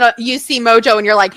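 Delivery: usual speaking voice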